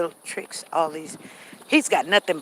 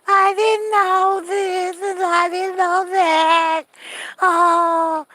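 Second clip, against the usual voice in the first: high, slow, hammed-up wailing; mock crying; 13 semitones higher, 9 dB louder, much slower than usual. Mother's family crying to the son, mock sobbing. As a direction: wail it like a drama queen. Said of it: "then they start crying".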